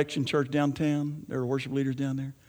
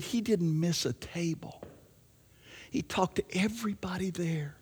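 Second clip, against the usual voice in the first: awed whisper; 8 dB quieter than usual. Retold or imitated. Husband telling his wife about the pastor, in a hushed whisper. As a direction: whisper it in awe.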